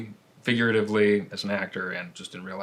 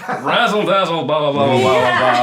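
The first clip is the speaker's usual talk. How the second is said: louder, hammed-up old-time radio announcer voice; teasing a mock showbiz announcer patter, louder; 8 dB louder than usual. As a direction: ham it up like a flashy radio host.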